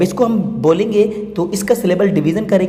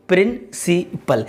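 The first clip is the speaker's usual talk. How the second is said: "Principal" in three slow, separate beats, प्रिं-सि-पल; syllable by syllable; much slower than usual. Shown as a demonstration. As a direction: break "principal" into three parts with clear pauses. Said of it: "syllable division आप करेंगे". ("Principal" को तीन हिस्सों में रुक-रुककर बोलें)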